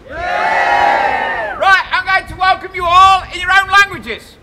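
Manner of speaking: loud ringing call to the crowd; town crier shout. Acted. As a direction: bellow it across the square.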